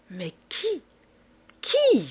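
stretched, wailing "qui"; anguished moan. A narrator's reading. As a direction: moan it in helpless frustration. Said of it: "gémissait Isidore en se tordant les poings".

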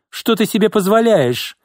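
high-pitched indignant outburst; indignant exclamation. A narrator's reading. Boss scolds her battered secretary, indignant. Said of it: "воскликнула Изольда".

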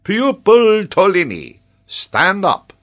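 loud, high, drawn-out command; solemn professor. A narrator's reading. Geographer begins examining the boy, pompous professor voice. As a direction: pompously summon a pupil to his feet. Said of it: "put on a solemn voice becoming to a professor".

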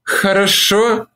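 slow, high, hesitant word; slow hesitant yes. A narrator's reading. Olivia finally accepts Mark, slow and hesitant. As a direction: let it come out slowly. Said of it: "молвила она наконец".

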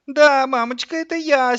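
put-on very high, whiny little-boy voice; whimpering child. A narrator's reading. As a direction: whine to mummy like a small child. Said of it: "сейчас же захныкал Славик". (хныкающий ребёнок)

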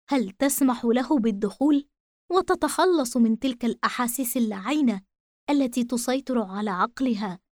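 plain reading voice

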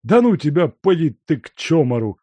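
high, loud waving-off; dismissive brush-off. A narrator's reading. Servant waving off the guest, high and dismissive. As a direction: shoo him off impatiently. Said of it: "отмахивался Лука".

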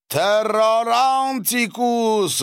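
long, high, loud holler; yelled out. A narrator's reading. Savva doused with icy water, yelling out. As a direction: holler it slowly at full pitch. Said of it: "выкрикнул он".

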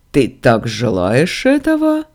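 taunting question from a god; amused, mocking challenge. A narrator's reading. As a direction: ask it with teasing scorn. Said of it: "насмешливо спросил голос".

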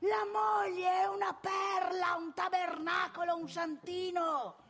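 high, ringing mock sermon with slow endings; predica gridata. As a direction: proclaim it, then scold just as hard. Predica gridata sulla moglie. (declamazione acuta e solenne)

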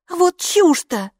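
high, slow scornful dismissal; scoffing. A narrator's reading. Hilla scoffs at Kapo's pocket theory. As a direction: dismiss it as nonsense.

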